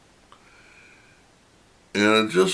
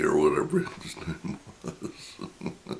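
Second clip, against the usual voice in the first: whispered laughing aside; 3 semitones lower, 9 dB quieter than usual. Real, not acted. Laughing aside about name.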